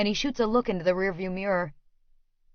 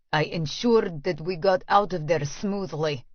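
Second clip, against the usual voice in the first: low Russian man; 3 semitones lower than usual. Acted.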